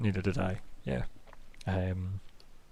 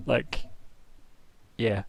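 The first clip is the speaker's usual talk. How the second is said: ordinary talk, nothing marked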